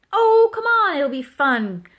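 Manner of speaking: high, loud opening, then dropping; quacked coaxing. A narrator's reading. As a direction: coax eagerly with a long plea. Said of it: "quacked Duck".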